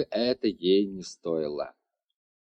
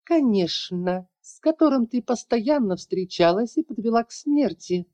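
mustache twirling villain; 11 semitones higher than usual. A narrator's reading. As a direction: hiss it out with a smirk. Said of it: "ехидно прошипела женщина, ухмыляясь".

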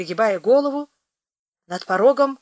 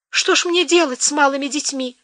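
sobbing; 7 semitones higher than usual. Acted. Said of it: "опять всхлипнула хозяйка".

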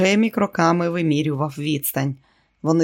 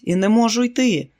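shouting back; 6 semitones higher than usual. A narrator's reading. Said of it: "крикнув він у відповідь".

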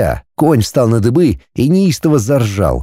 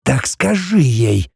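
Basilisk's voice; 3 semitones higher, slower than usual. A narrator's reading. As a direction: hush it like an ancient serpent.

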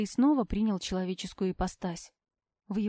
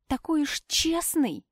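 drawn-out mocking «честный», pitched up; mocking, stress on честный; 6 semitones higher, slower than usual. A narrator's reading. Heroine mocks Shan, stressing «честный». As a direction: slowly mimic him, leaning hard on «честный». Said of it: "передразнила его я, сделав упор на последнее слово".

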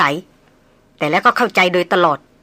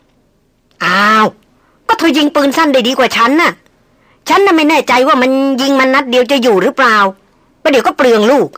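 higher, louder coaxing plea; persuading voice; 5 semitones higher, 4 dB louder than usual. A narrator's reading. Man urges Darin to shoot instead, higher coaxing. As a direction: talk her into it, pressing.

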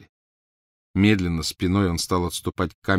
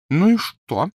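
dismissive Crabbe; 11 semitones higher, 5 dB louder, slower than usual. Acted. Crabbe shrugs off Malfoy.

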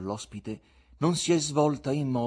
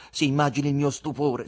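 ordinary delivery

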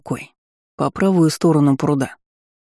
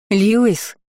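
raised, higher-pitched call; 6 semitones higher than usual. A narrator's reading. Countess searching the grounds, calling out. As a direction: call the name across a distance. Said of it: "вновь позвала Ролана".

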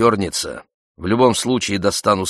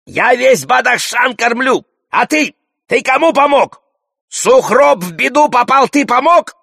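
high, indignant shouted challenge; loud haranguing; 7 dB louder than usual. A narrator's reading. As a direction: yell accusations as the loudest in the crowd. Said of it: "Горбун вновь солирует". (возмущённо орёт, перекрывая всех)

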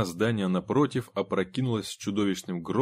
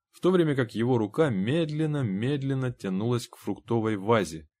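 ordinary delivery, nothing marked

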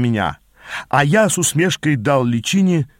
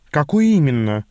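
plain reading voice

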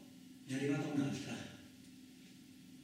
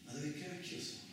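muttered; 9 dB quieter than usual. Acted. Muttering in a sleeping room.

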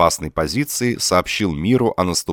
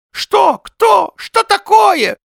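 put-on high, loud old man's cry; startled old man shouting questions; alarmed shout; 9 dB louder, much slower than usual. A narrator's reading. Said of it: "закричал беспокойный старик".

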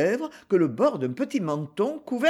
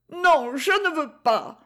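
high, slow, whimpering squeak; 9 semitones higher than usual. A narrator's reading. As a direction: whine it out like a small child. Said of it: "piaula Gigi".

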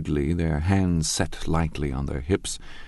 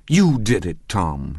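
louder, higher accusation; accusing; 3 semitones higher, 5 dB louder, slower than usual. Acted. Daisy blaming Tom for her finger, accusing. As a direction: point the finger at him with your voice. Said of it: "she said accusingly".

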